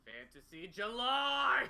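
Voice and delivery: high, drawn-out playful holler; hammed-up, stretched yell; mock triumphant shout. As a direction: belt it out slowly like a silly proclamation. Repeats the shouted joke.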